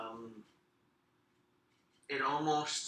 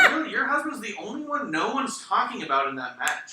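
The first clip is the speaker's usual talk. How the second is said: mock disbelief; 9 semitones higher, 9 dB louder than usual. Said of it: "And I was like".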